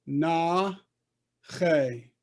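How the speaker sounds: "Na che" in two separate beats; teacher repeating "Na ... che"; syllable-by-syllable demo. Shown as a demonstration. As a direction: hold "Na", pause, then "che". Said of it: "So this one would be".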